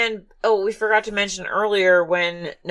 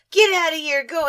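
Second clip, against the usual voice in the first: mock yell; 9 semitones higher than usual. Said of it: "yelling at Samantha".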